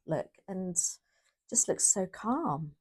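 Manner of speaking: usual speaking voice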